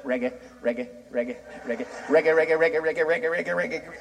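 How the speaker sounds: engine sputter. Pretend. Imitating sputtering Jamaican car engine.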